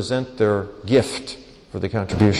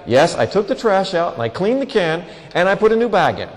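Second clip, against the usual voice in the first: put-on child reciting; 5 semitones higher than usual. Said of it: "They go".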